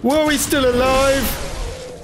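drawn-out, high-pitched disbelief; high, astonished cry; startled. Real, not acted. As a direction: exclaim slowly in high surprise. Startled that an enemy lives.

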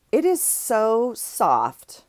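dreamy, drawn-out praise; slow adoring. Real, not acted. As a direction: stretch it out like stroking the fabric. Swooning over a soft pillowcase.

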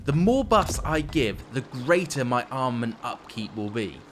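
ordinary talk

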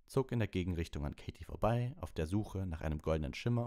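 plain reading voice

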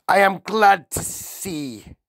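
a hint of a stern, threatening headmistress; menacing headmistress. A narrator's reading. Narrator voices the Trunchbull.

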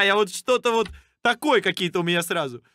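ordinary talk, nothing marked

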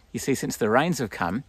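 ordinary talk, nothing marked

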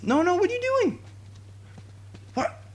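very high, squealed panic; frantic high-pitched outburst; panicked protest. Real, not acted. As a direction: squeal it in disbelief. Panics at teammates' blunder.

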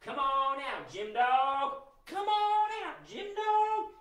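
high, sing-out call to a dog; big calling voice. Pretend. Entertainer leading the kids in calling out Jim Dog, big voice. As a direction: call him out big and high, drawing out the name. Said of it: "call him out in a big voice".

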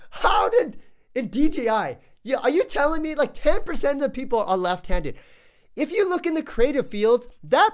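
can't believe the company ignored lefties; indignant rant. Real, not acted. Left-handed reviewer ranting at DJI, indignant and loud.

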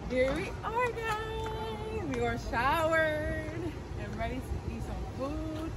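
very soft hushed talk. Real, not acted. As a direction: keep it down, near whisper. Quiet at night.

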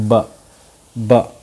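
"ब" as a short, separate "buh"; letter's sound. Shown as a demonstration. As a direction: repeat "ब" with a pause between. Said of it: "क्या होता है?". ("ब" रुककर दोहराते हुए)

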